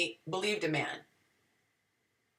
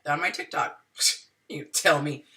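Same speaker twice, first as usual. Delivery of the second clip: normal talking voice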